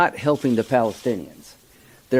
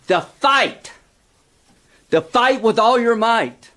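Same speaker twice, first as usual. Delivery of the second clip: higher-pitched, emphatic oratory; impassioned speech; 6 semitones higher than usual. Real, not acted. Senator urging that Israel may fight back, impassioned. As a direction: press each point hard, voice raised in pitch. Heated plea defending Israel's response.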